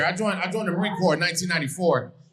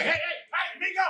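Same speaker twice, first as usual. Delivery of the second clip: high far-off hollering, calling him over; guy shouting for him; 7 semitones higher, 4 dB quieter than usual. Retold or imitated. Contractor hollering across the parking lot to him. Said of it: "he's like".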